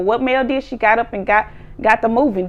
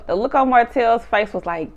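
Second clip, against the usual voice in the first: ordinary delivery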